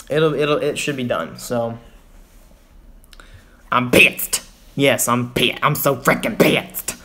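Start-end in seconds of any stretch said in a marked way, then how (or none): 3.7-6.9 put-on angry voice, each word punched; hammed-up outrage, drawn out at the end; mock pissed off. Streamer tells chat how mad he is, mock angry.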